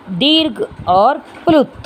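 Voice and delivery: usual speaking voice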